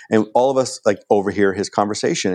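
usual speaking voice

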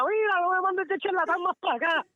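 high, put-on complaining voice; complaining store clerk. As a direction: whine to the company to send someone else. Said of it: "Allá llaman a la compañía". (queja aguda imitando al encargado)

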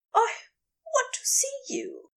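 high, whispery, breathless plea. A narrator's reading. Aziraphale asking to look at Crowley, breathless. Gasping lover voices his wish.

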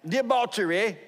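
high-pitched British attempt at debauchery; British accent. Shown as a demonstration. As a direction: try a showy British "debauchery". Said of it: "I just can't say it that way".

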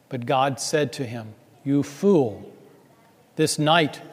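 usual speaking voice

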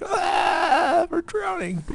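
high hammed-up panic shout; mock drowning cry. Telling the podcast how they floundered, a hammed-up drowning yell. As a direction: cry out like you're going under, all in jest.

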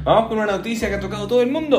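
upbeat news lead-in. Acted. Host introducing the first news story, brisk announcer tone. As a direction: kick off the headline with energy.

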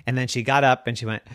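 plain reading voice

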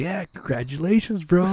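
lightly sends up the chatty caller; mocking caller voice. Friend on the phone, voiced by the narrator, lightly mocking.

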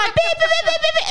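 very high, rapid imitated beeping; scooter horn beeps. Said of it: "we're beeping the scooter horn".

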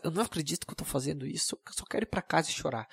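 very soft, quick inner panic; inner thought, hushed. Retold or imitated. Nervous guy thinking while asking a girl out, soft and quick. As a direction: mutter it fast under your breath like a nervous thought. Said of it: "ele pensa".

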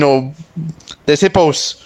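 ordinary talk

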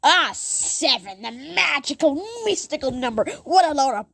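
mock the magic number like you hate it; mocking hater. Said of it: "I sound like I'm just hating on Harry Potter".

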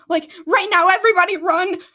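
loud, high urgent cry; urgent shout. Acted. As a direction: yell at everyone to run. Magma urges everyone to flee.

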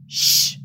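sharp unvoiced shh to silence him; hissed shush. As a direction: hiss to shut him up. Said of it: "Aziraphale hissed".

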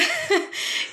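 very high, moved little laugh; emotional laugh. Real, not acted. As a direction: laugh to hold back tears. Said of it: "before I get more emotional".

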